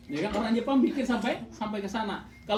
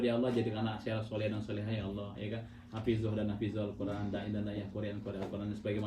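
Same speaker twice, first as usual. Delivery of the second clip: soft prayer; 12 semitones lower, 8 dB quieter than usual. Acted. Voicing a bedtime prayer.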